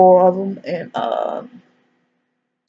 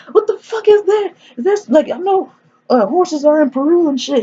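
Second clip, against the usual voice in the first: very high, incredulous mock panic; mock freak-out; 11 semitones higher than usual. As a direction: imitate a man losing it, high-pitched. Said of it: "And he's freaking out".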